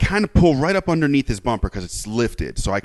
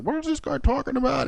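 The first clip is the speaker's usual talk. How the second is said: very high, softer mimicking voice; mock innocence; 13 semitones higher, 5 dB quieter than usual. Driver complaining to his grandfather, mock-innocent. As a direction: imitate someone playing baffled.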